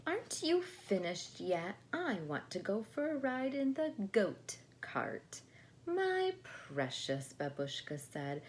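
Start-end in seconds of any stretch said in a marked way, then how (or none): 0.1-5.4 higher, whiny demanding; a touch of childish whine; impatient child. Acted. Very demanding Natasha. 5.8-7.3 much higher, slow and fond; slow sing-song endearment; doting grandma. Acted. Grandma soothing child.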